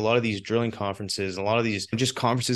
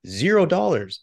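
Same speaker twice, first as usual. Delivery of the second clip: higher, louder punchline delivery; proud reveal; 7 semitones higher, 5 dB louder than usual. Retold or imitated. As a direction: drop the price like a mic. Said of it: "I was like".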